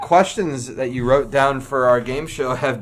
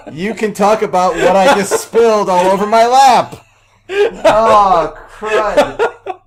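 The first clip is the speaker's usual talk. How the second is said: laughing dismay; 2 semitones higher, 5 dB louder, much slower than usual. Real, not acted. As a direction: helplessly laugh at your own disaster. Spilled drink over own lap.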